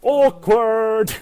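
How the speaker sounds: high, loud sing-song "Awkward!"; comic exclamation. Pretend. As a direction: call it out for laughs. Comic exclaim after mock panic.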